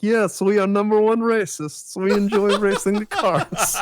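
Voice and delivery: put-on high, loud foreign-accented English; hammed-up foreign accent, pitched high and loud; second-language English accent. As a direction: imitate eager non-native English speakers. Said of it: "it's English as a second language".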